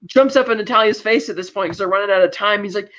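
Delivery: usual speaking voice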